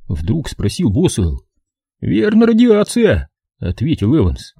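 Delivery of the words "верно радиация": Evans voice. A narrator's reading.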